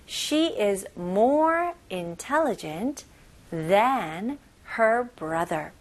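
very slow, every word spelled out; teacher's slow drill reading; slow model reading. Shown as a demonstration. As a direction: read it slowly for learners to copy. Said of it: "slow syllable-by-syllable model".

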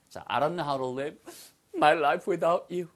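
ordinary talk

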